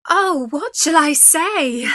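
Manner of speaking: high, loud, drawn-out cry of dismay; distressed cry. A narrator's reading. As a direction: cry out in a fearful quandary, slowly. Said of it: "cried Beauty".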